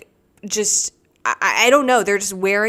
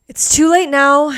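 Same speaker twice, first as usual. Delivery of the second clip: higher and louder, punching late; emphatic repeat; 7 semitones higher, 8 dB louder than usual. Real, not acted. Telling viewers the suitcase can't be returned, emphatic. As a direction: blurt it out as a final verdict.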